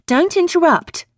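high sharp schoolmarm scolding; prim schoolmarm. Acted. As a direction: scold like a strict teacher. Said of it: "Her voice was like a schoolmarm's".

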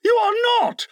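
high, escalating denial; captain's frantic contradiction; hoarse cry. A narrator's reading. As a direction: cry it out, rising to a high pitch. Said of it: "Hook cried hoarsely".

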